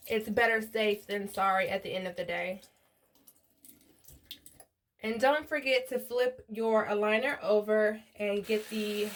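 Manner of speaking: quiet, even how-to narration; gentle tutorial voiceover, held well below normal level; soft voiceover. Acted. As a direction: talk viewers through the cleaning steps softly.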